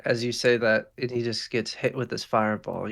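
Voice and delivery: ordinary delivery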